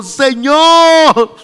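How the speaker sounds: loud high cry of "Señor"; trembling Saulo. Acted. Saulo crying out to the Lord, loud. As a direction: cry out to God in awe. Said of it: "temblando y temeroso".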